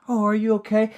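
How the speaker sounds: lightly voiced mock-caring villain; feigned concern. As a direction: pretend to care about him.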